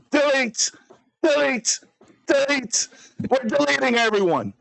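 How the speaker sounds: high, separated orders with a pause after each; high-pitched mock outrage; mock-angry command. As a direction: fire off each command high in pretend fury. Streamer's mock-rage purge of chat.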